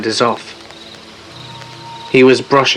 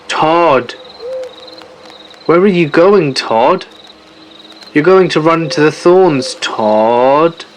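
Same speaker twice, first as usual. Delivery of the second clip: mimicked voice calling; 4 semitones higher, slower than usual. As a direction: call out through the dark like an unseen mimic. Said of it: "we hear".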